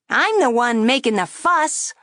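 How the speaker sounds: high, loud wisecracking puppet; a brash ventriloquist dummy needling; cheeky dummy voice. A narrator's reading. Dummy mocking the girl at the table, high and brash. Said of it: "the dummy said".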